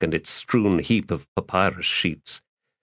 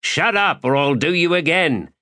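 gruff threat; 9 semitones higher than usual. Acted. Thief snapping.

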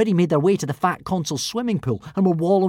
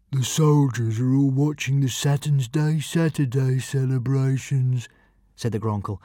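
slow weary dragon voice; 2 semitones lower, slower than usual. A narrator's reading. The Gronkle voiced.

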